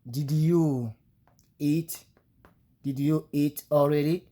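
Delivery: "Did you eat already?" said slowly with a rise on "already"; English example, slow. Shown as a demonstration. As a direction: model the English question slowly. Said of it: "tu es obligé de dire".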